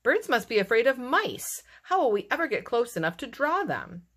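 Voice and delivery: high, exasperated complaint; grumpy. A narrator's reading. Mole despairs of drawing birds, grumpy high complaint. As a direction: whine in frustration. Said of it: "grumped Mole".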